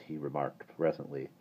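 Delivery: usual speaking voice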